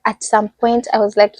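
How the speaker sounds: normal talking voice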